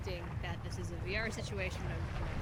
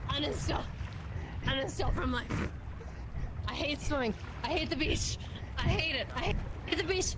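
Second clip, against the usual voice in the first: higher, hammed-up distressed wail; in-character meltdown, rising higher to the end; distraught whine; 4 semitones higher, 14 dB louder than usual. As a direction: whine that you hate the beach. Panicked character on beach.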